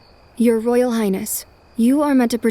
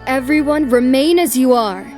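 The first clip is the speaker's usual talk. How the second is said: raised, firm order; commanding; 2 semitones higher, 6 dB louder than usual. Acted. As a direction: halt everyone with authority. Rishi commands the onlookers.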